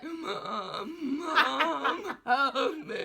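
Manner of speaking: hammed-up crying for help, laughing through it; strangled plea, half laughing; mock choking plea. Choking in the car, calling to mum in a hammed-up cry.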